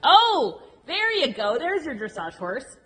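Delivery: loud, high, thrilled praise; excited praise. Real, not acted. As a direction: cheer the rider on. Coach praising rider.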